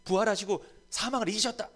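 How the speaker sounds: high, loud defiant confession; preacher's fervent shouted creed; bold confession. Shown as a demonstration. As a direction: declare it boldly to death. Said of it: "스스로 얘기하세요. 고백하세요".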